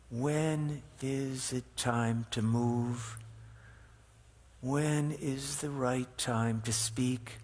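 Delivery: slow, softening reflective questions; guided meditation. Acted. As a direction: ease them into stillness, slowly.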